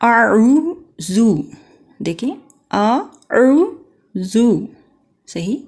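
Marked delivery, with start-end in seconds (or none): 0.0-4.4 اعوذ drawn out very slowly with a falling tune; tajweed demo. Shown as a demonstration. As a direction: sing out اعوذ long and slow for students to copy. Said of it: "آپ بھی میرے ساتھ پڑھیں". (اعوذ کو کھینچ کر آہستہ پڑھیں)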